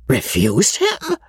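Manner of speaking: put-on baffled old lady's question; bewildered. A narrator's reading. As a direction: echo it slowly, utterly baffled. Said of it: "more bewildered than ever".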